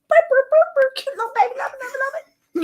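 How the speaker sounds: put-on very high cooing baby talk. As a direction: coo it squeaky like to a newborn. Cooing over the baby lamb.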